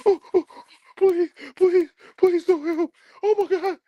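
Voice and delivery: high, whiny mocking falsetto pleading; squealing high fake-crying plea; mock crying Kane. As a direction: ham up a sobbing mama's boy.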